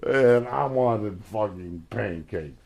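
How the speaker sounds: mock-whine it like a teenager ranting about her dad; whiny teen complaint. Daughter griping to friends about crepes, loud mock whine. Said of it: "she was like".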